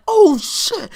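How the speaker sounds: high, loud mock alarm; shocked onlookers. Imitating everyone seeing the car coming, alarmed cry. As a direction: exclaim like a startled crowd.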